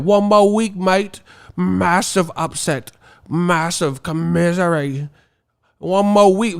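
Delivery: high, hammed-up British accent; mock British fan. UK fan answers about the fight, mock British accent. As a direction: draw out the British catchphrases in parody. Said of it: "the British teeth be like".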